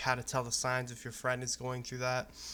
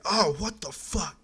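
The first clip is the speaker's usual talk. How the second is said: imitated freak-out, high and loud; mock shocked yell, slow and high; Markiplier; 7 semitones higher, 6 dB louder than usual. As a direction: stretch it into a startled outburst. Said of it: "And he'd freak out and be like".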